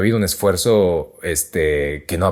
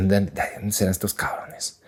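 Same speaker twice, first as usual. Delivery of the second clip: hushed wondering; 7 dB quieter than usual. Retold or imitated. Wondering about strangers on bus.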